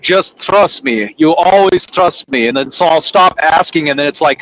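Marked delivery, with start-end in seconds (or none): 0.0-2.5 slow, drawn-out just trust me; boss reassuring. Retold or imitated. Boss slowly reassuring impatient driver Josh. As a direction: stretch it out like a boss calming you down. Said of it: "And he's like".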